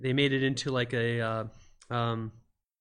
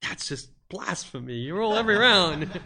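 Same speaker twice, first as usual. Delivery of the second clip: mock outrage; 6 semitones higher, 4 dB louder than usual. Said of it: "And I'm like".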